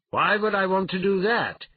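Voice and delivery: loud, high-pitched answering call; calling back. Acted. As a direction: call the question back to the beast. Calling back to roaring brute.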